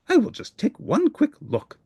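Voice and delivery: a monster talking himself into a peek; Grover thinking. Acted. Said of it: "he thought".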